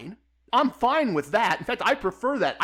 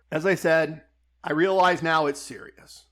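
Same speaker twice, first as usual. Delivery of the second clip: ordinary delivery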